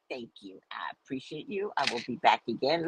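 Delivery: soft, low, placating thanks; hushed friendly appreciation; polite thanks. Retold or imitated. Placating the shop man politely.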